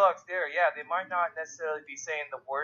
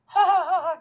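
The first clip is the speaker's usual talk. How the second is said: much higher, louder fake giggle; mock giggling girls; 14 semitones higher, 6 dB louder than usual. Girls giggling at the older brother, high mock giggle. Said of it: "And they're like".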